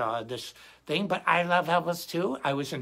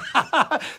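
amused laugh; 11 semitones higher, 8 dB louder than usual. Real, not acted. Laughs at own joke.

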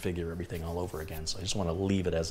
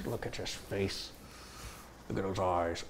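soft cooing; 9 dB quieter than usual. Real, not acted. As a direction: murmur lovingly to a pet. Cooing softly at the dog.

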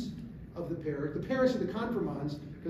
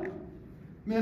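ordinary delivery

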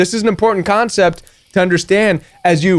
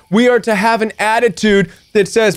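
ordinary delivery

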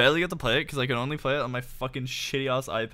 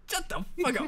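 mock voice; 11 semitones higher, 4 dB quieter than usual. Teasing friend in odd voice.

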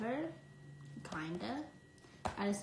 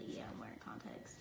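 very quiet, whispery aside; hushed mock admission; 11 semitones lower, 12 dB quieter, faster than usual. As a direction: drop to a low, near-voiceless murmur. Acts out a hypothetical admission.

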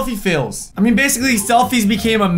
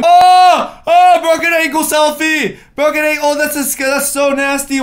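higher, louder disgusted shriek; grossed-out shriek; 6 semitones higher, 5 dB louder than usual. Real, not acted. Seeing a broken ankle selfie, grossed out shriek. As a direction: recoil at the gross photo.

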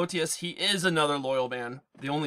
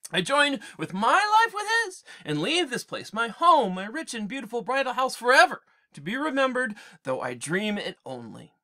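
queen lamenting her home, lifted and earnest; a touch of sorrowful queen; reading her goodbye with feeling; Penelope's farewell; 10 semitones higher, 6 dB louder than usual. Acted.

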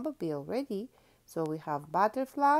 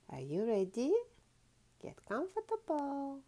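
usual speaking voice

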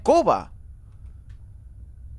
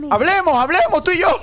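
high, frantic bargaining; panicked pleading; 9 semitones higher, 4 dB louder than usual. Real, not acted. Player bargains with the attacking witch, panicked pleading. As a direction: beg loudly to talk it over.

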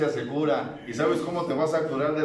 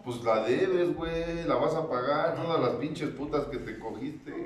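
normal talking voice

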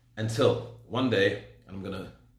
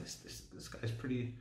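much softer, as if thinking to himself; quiet musing; 2 semitones lower, 15 dB quieter than usual. Retold or imitated. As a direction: murmur it privately, half-formed thought. Recalls his hushed thought while listening.

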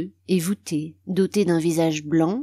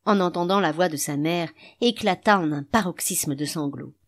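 ordinary delivery, nothing marked

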